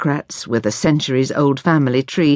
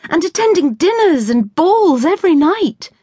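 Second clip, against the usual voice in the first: protesting; 13 semitones higher, 3 dB louder than usual. Acted. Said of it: "Ruth protested".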